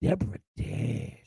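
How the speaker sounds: lightly voiced vacant old man; imitating dazed father. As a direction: mutter Deborah dead slow and soft, like a man gone vacant. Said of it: "he whirled and go like this".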